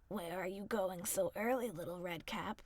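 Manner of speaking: low cunning wolf; sly wolf. A narrator's reading.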